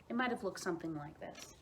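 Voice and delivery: ordinary delivery, nothing marked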